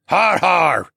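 put-on loud, high old man's har har; hearty cheer. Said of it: "Tovish cheered".

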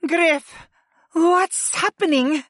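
very high, panicked woman character; high, alarmed cry for help; frantic voice. A narrator's reading. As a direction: demand answers in a panic. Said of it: "demanded in a frantic voice".

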